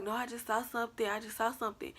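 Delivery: mildly rattled. Retold or imitated. Telling worried friend what was seen, mildly rattled. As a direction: lightly imitate your own shaken answer. Replaying scared answer to friend.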